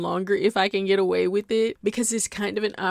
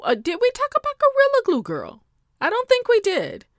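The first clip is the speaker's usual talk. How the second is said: high gossip; 4 dB louder than usual. Real, not acted. Excited squeal asking viewers about Gorilla Glue Girl.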